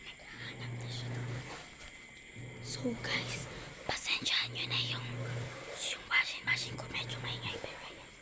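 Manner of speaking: hushed whisper in hiding; whispering while hiding. Real, not acted. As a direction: whisper so you stay hidden. (pabulong habang nagtatago)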